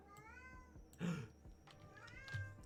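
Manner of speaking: high startled gasp; sharp gasp. Real, not acted. Cat startles her, high sharp gasp. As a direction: gasp in sudden alarm.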